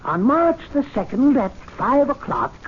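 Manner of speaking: lightly declaiming the notice; a touch of proclamation; drafting the notice aloud. Acted. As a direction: read it out like an announcement. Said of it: "I've got it".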